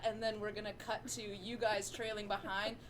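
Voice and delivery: ordinary talk, nothing marked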